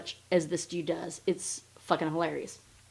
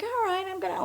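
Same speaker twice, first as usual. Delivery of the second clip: high, light feigned compliance; playing it cool; 12 semitones higher, faster than usual. Woman hiding that she knows, mock-casual. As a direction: act innocent, pitched way up. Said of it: "playing it cool like she doesn't".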